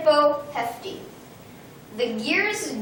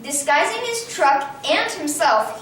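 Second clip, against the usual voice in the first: plain reading voice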